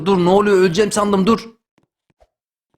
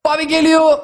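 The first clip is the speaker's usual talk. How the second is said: high, held victory calls; excited winner's proclamation, rising to a cry; triumphant self-announcement; 5 semitones higher, slower than usual. Real, not acted. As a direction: herald your own win, pitched up. Announces his own win.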